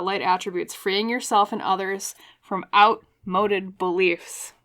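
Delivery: ordinary talk, nothing marked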